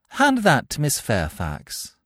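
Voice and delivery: slow, pointed order to a servant; cool command. Acted. Cecily instructing Merriman, slow and pointed.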